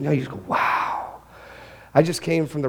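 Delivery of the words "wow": awed wow. Retold or imitated. Awe at a sunset.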